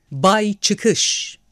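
triumphant shout. Acted. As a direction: proclaim it like a winner. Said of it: "Zafer kazanmışçasına ... diye bağırdı". (zafer kazanmış gibi bağırarak)

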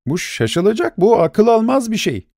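high, disbelieving exclamation; a stunned banker who can't believe it; astonished. A narrator's reading. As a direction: voice jumps up in shock.

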